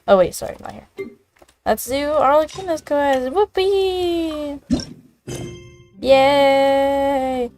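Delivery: ordinary talk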